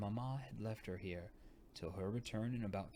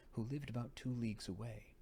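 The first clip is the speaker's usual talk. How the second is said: plain reading voice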